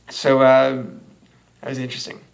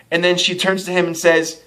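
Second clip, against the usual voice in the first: ordinary talk, nothing marked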